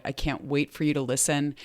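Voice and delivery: usual speaking voice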